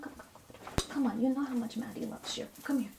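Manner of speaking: ordinary talk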